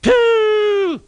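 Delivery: very high, loud squeaky scoff; indignant Henry scoffing at Gordon's idea. A narrator's reading. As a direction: blow it off like letting off steam. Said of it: "let off steam indignantly".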